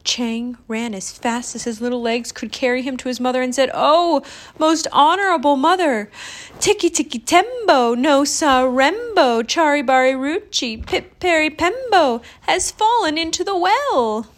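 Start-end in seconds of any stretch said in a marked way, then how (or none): 3.7-14.3 put-on higher, louder child calling out; urgent child's cry. A narrator's reading. Chang tells mother brother fell, urgent cry. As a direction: sound the alarm, raised and urgent.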